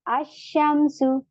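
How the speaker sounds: अशमसु very slow, with the a going straight into a doubled sh; silent lam. Shown as a demonstration. Teacher shows the students a sun letter. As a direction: stretch अशमसु without any l. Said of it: "लाम नहीं पढ़ रहे".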